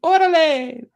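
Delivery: high, loud mock Chicano call; a hammed-up Chicano "Orale"; mock Mexican slang. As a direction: shout it like a street greeting. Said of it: "different kind of coyote".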